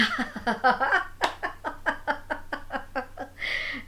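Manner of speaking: drawn-out hearty laugh of the sage; long amused laugh. Acted. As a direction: laugh long at the failed trick. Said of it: "And Agastya laughed".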